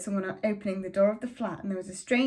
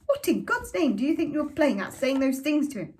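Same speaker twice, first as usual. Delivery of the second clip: higher and louder, accusing; furious mother; 6 semitones higher, 5 dB louder than usual. A narrator's reading. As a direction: hurl the accusation at him. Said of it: "And Mother shouted".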